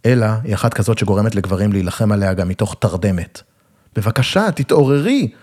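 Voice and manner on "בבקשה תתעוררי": higher, melodramatic plea; schmaltzy cry. A narrator's reading. Man begging his comatose beloved to wake, schmaltzy crying out. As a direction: cry out with hammy sentimentality. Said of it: "זעקתי בשמלציות".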